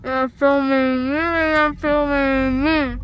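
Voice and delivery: ordinary delivery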